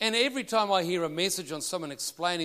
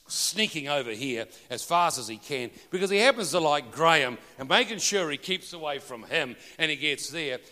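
normal talking voice

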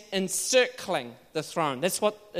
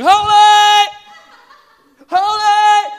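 a much higher, much louder ringing shout of holy; awed cry; 13 semitones higher, 15 dB louder, much slower than usual. Shown as a demonstration. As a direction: cry out holy in overwhelming awe. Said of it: "They're going".

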